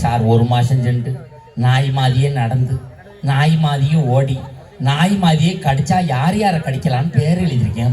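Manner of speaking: low, monotone schoolboy reporting to sir; pupil reciting back. Acted. Pupil earnestly repeats the warning back. (தாழ்ந்த குரலில் ஒப்பிக்கும் மாணவன்)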